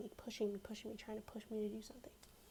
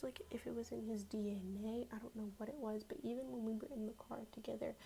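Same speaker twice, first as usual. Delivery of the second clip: ordinary talk, nothing marked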